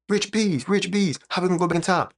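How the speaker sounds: imitating a viewer. Streamer voicing a viewer asking him, high and eager. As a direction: play the curious fan begging to know. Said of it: "you say?".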